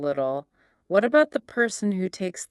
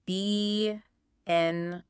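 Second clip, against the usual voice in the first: monotone robot, softer, every syllable equal; 5 dB quieter, slower than usual. Shown as a demonstration. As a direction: spell it out as evenly spaced robotic syllables. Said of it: "how would a robot say that word".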